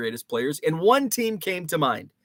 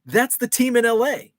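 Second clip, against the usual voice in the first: imitating his wife, higher and louder; insistent wife; 8 semitones higher, 5 dB louder than usual. Husband mimicking wife insisting the Lakers are LA's team. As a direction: make her sure of herself. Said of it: "And she's like".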